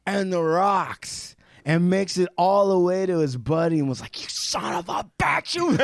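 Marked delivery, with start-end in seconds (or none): 4.2-5.8 high, strained mock fury; mock outraged accusation. Climber confronting the buddy who cut the rope, high mock outrage. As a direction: spit it out at the betrayer.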